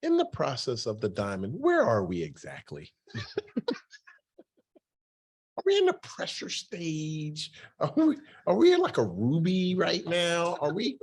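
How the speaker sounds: put-on high, far voice of a smart kid, rising questions; kids quizzing coach. Coach's players mockingly asking where they are in the diamond story, high kid voice. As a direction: play the curious kid poking fun at coach. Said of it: "And it was like".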